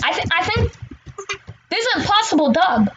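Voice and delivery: ordinary delivery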